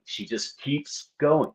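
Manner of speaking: said slowly and softly, exasperated; slow and quiet. Real, not acted.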